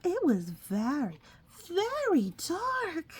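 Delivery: put-on high, slow childlike voice; squeaky little-bat voice. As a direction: stretch out very dark like a tiny bat.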